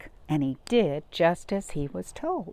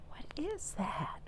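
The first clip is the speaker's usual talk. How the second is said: breathy secret whisper; 8 semitones higher, 12 dB quieter than usual. Acted. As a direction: whisper it to draw the kids in. Whispered suspense to kids.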